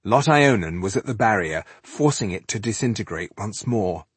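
ordinary delivery, nothing marked

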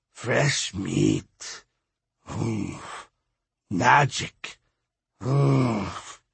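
slow raspy skeleton grunting words; deep raspy voice. A narrator's reading. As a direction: grunt each word out, rasping.

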